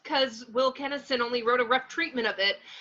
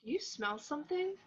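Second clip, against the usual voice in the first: very quiet, wary question; hushed; 14 dB quieter than usual. Acted. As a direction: whisper it suspiciously. Sniffing in the dark.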